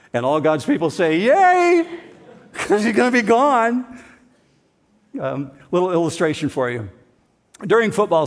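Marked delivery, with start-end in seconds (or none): 1.2-1.9 high, loud excited yay; cheering yay. Acted. Pastor leading a cheer for God's people, high joyful yay. 2.2-4.0 laughing. Real, not acted. Laughing after the cheer.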